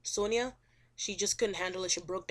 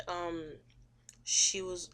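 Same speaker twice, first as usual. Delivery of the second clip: ordinary delivery, nothing marked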